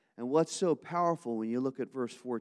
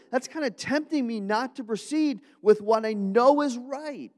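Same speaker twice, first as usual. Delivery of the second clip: higher and louder, pleading as Paul; pained Paul; 10 semitones higher, 7 dB louder than usual. Acted. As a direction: explain the temptation in anguish. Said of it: "read between the lines".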